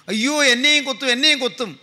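mildly acted panicky child, quick cries; imitated child's wail. Younger brother afraid he will be stung next, mock wailing. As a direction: lightly imitate a scared child crying out. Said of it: "കുട്ടി ഇങ്ങനെ ഉച്ചത്തിൽ കരയുകയാണ്".

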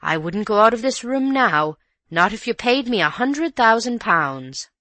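alarmed. A narrator's reading. Housekeeper too scared to leave the room, alarmed.

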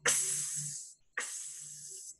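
"X" hissed, barely voiced; whispered x sound. Shown as a demonstration. Teacher hissing the fox letter sound. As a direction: breathe out "X" as a soft ks. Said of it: "Xavier fox".

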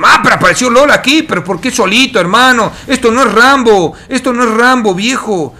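high, loud scolding; exasperated shout. Real, not acted. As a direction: scold the reckless play. Caster scolds a solo dive.

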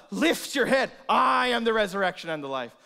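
loud, high commanding proclamation; preacher's ringing announcement; bold declaration. Acted. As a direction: lift her up with authority. Jesus to Martha, emphatic.

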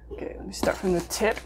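soft unvoiced whisper. Real, not acted. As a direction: hush it to yourself. Starting quietly on camera.

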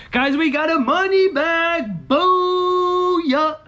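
high, stretched-out celebratory cries; excited whooping. Real, not acted. Scratcher announces the money bag to viewers, excited whooping. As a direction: whoop it up high, dragging the vowels. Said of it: "I get so excited".

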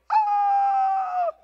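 long, high, loud wail of dread; mock terrified scream. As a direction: scream as if exposed before God. Acts out dread before God.

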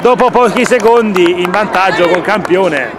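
rising excited call of the goal; goal commentary. Acted. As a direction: stretch out the scorer's name. Calls a goal in play-by-play. (telecronaca del gol)

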